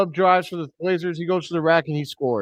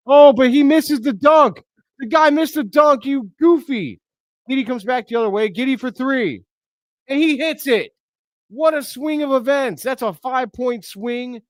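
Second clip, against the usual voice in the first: much higher and louder, thrilled fan yelling; real excited shout while calling the game; 8 semitones higher, 5 dB louder than usual. Real, not acted. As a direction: ride the swing, yelling high with glee. Missed dunk then Bulls three.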